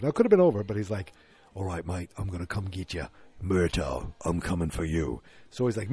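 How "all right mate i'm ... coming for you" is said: low, imitated Australian villain threat; menacing Australian caller. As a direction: drop low like an Aussie movie villain.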